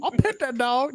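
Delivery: high, put-on cheeky kid voice, lightly done; mock taunt. Echoing his co-host's taunt in a high mock-kid voice. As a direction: tease him back in a high singsong.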